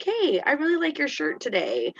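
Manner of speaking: modelled cheerful remark. Retold or imitated. Teacher modelling a friendly comment for the student, lightly sing-song. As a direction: show him how to compliment someone.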